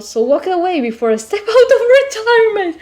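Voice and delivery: can't keep it together mid-line; laughing. Real, not acted. Player reading the stranger's threat, breaking into laughter.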